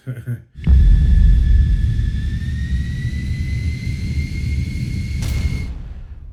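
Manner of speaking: big airy cackle that keeps going; long loud laugh. Real, not acted. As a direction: lose it completely laughing. Bursts out laughing at spoilers.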